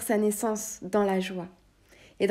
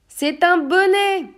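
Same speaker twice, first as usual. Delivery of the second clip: slow, much higher and louder, disbelieving; outraged exclamation; 11 semitones higher, 10 dB louder, slower than usual. Acted. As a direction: hurl each word in outrage. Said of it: "s'exclama le chevalier".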